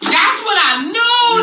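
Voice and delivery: high stunned what, then slowed knowing line; shocked disbelief. Real, not acted. Storyteller relives the shock of the Fred news, stunned. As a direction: gasp out what, then drag it slowly. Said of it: "reacts to baby news".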